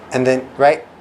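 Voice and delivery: ordinary talk, nothing marked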